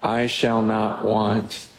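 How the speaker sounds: slow, reverent reading voice; measured psalm recitation; scripture reading. Acted. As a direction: read the psalm slowly and solemnly. Reads Psalm 23 aloud.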